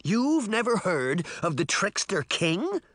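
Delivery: high, incredulous rising question; gasping disbelief in a high boy voice; shocked. A narrator's reading. Boy learning girls never heard of him, shocked.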